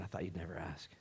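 soft whisper of the Lord; tender whispered answer. Retold or imitated. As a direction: breathe it gently, no voice.

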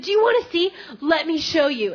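high, loud eager offer; bursting to show a friend; excited retold urging, pitch jumping up; freaking out with excitement. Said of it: "he's freaking out".

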